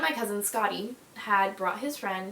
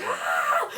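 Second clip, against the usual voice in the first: retold cry of pain; 4 dB louder than usual. Getting up, arm hurt.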